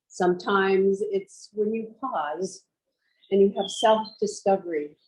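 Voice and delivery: measured yoga-teacher voice with long pauses; guided meditation. Real, not acted. Teacher guiding students to picture home, meditative delivery. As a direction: guide them inward, leaving space after each phrase.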